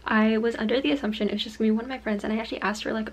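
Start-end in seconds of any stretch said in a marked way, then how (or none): none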